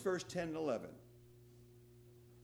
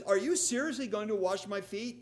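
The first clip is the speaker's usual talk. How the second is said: incredulous, indignant rising question; disbelieving Peter, louder and higher; 6 semitones higher, 6 dB louder than usual. Retold or imitated. Peter protesting to Jesus, indignant. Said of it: "With a little tone of indignation".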